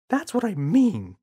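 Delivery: fed-up mum; mother's voice. Acted.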